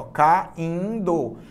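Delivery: "Caindo" split into ca, in, do; syllable by syllable. Shown as a demonstration. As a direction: say "Caindo" in three drawn-out syllables. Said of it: "separa as sílabas comigo".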